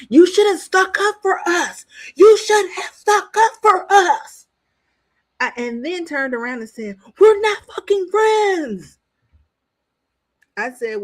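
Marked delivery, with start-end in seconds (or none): 0.1-1.8 higher and louder, accusing yell; angry outburst. Retold or imitated. Angelina confronting the housemates. 2.2-4.5 slower, higher, louder tearful accusation; crying yell. Retold or imitated. Repeated, crying, retold. 7.2-9.0 slow, high, loud shouted retort; angry shout. Retold or imitated. Housemate snaps back, retold.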